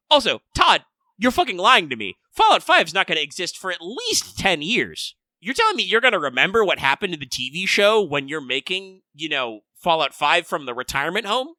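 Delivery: loud exasperated rant; mock rant. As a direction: call him out loudly. Ranting at Todd Howard.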